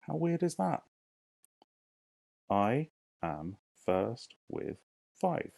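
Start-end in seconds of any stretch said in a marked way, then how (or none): 2.5-5.5 drawn out, syllable by syllable; slow syllable count. Shown as a demonstration. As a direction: count it out slowly. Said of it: "teacher counts syllables aloud".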